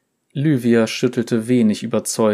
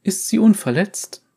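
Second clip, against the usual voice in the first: female character voice; 10 semitones higher, 3 dB louder than usual. Acted. Sin asks.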